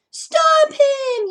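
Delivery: high loud shout; yell. Acted. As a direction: call out for help. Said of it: "yelled the gardener and Starvin' Marvin".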